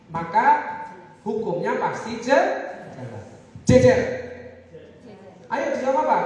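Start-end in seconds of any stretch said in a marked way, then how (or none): 3.7-4.0 loud, short, emphatic call; firm intonation. Shown as a demonstration. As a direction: drill it firmly into the class. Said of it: "kalau saya ngomong tegas". (intonasi tegas dan lantang)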